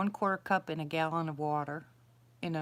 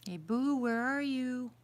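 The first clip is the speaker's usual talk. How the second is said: calling out; 7 semitones higher, slower than usual. Real, not acted. Calling out for the owl.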